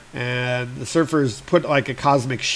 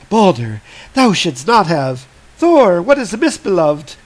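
alarmed Sif; 4 semitones higher, 5 dB louder than usual. Retold or imitated. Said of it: "and Sif is like".

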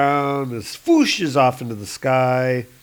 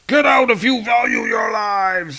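louder, higher menacing threat; angry threat; 10 semitones higher, 5 dB louder than usual. Acted. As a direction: snarl it at the staff. CEO rages, eyes glowing.

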